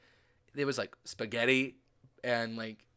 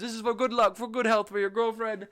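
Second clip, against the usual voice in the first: street seller; 7 semitones higher than usual. Retold or imitated. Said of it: "and he goes".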